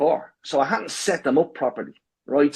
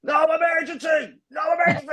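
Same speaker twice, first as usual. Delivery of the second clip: high, yelled at the phone; shouting. Retold or imitated. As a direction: bellow it desperately.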